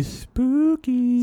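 high, long drawn-out mock-spooky voice. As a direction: stretch it out eerily, way up high. Spooky lead-in to Spook Fest.

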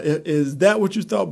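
ordinary talk

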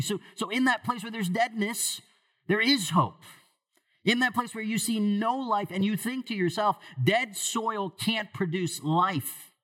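high, urgent preaching voice; fervent preaching. Acted. Pastor urging the congregation, high and fervent. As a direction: preach hope into the dead places.

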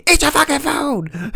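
high, shrill mock yell from mom; mock angry mom. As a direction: snap at the kid like a fed-up mother. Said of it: "she got so mad".

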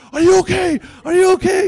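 high, loud anxious calling; worried shout. Retold or imitated. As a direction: call out from the bank in alarm. Friends calling to sprayed man.

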